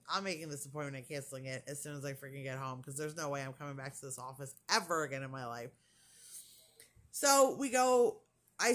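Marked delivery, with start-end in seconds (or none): 0.1-5.7 low, rapid grumble to oneself; fed-up inner thought. Retold or imitated. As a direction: rattle it off quietly, fed up. Retells her angry private thought.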